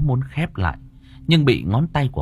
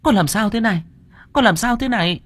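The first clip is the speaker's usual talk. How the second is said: rising high, anxious plea; alarmed calling; 8 semitones higher than usual. A narrator's reading. Bà Hỏa calls to convulsing Đào Nương, alarmed. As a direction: call her back to consciousness, voice climbing higher.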